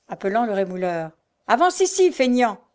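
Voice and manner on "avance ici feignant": loud, high summons; shouted order. A narrator's reading. Mother summons her lazy son, shouting. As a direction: call him over with rough impatience. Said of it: "s'écria-t-elle".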